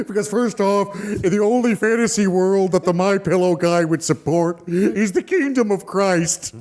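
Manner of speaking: put-on spokesman voice; drawing out the final phrase; My Pillow guy. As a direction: hold forth in an exaggerated character voice. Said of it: "Can you do the recap as the Mil-, My Pillow guy".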